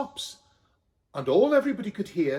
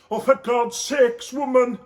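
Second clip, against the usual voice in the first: exasperated Joseph; 6 semitones higher, 7 dB louder than usual. Acted. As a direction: drag it out, fed up with his wife. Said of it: "said Joseph".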